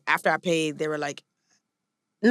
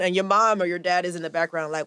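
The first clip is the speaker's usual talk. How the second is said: usual speaking voice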